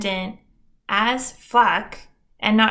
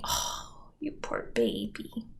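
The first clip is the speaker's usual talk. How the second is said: pity voice; 11 semitones lower, 11 dB quieter, slower than usual. Retold or imitated. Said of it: "my parents consoled me".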